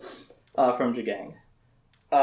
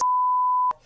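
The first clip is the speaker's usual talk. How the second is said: high giggle. Real, not acted. Laughs mid-retelling.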